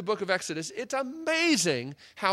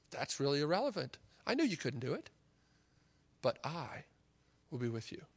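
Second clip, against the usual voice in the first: gentle reassurance; 3 semitones lower, 6 dB quieter than usual. Acted. God's gentle reply voiced.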